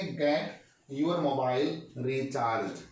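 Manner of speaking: stretched, deliberate teacher read; very slow model sentence. Shown as a demonstration. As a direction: drag each word so learners catch it. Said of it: "बोलिए क्या बोलेंगे?".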